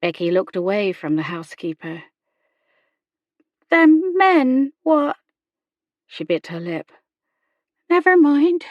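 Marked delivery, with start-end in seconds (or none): 3.7-5.2 put-on high, loud girlish voice; halting servant girl, pitched well up; haunted young girl. A narrator's reading. Girl Becky haltingly confessing. 7.9-8.7 put-on high, loud girlish voice; dismissive young girl. A narrator's reading. Girl Becky brushing it off.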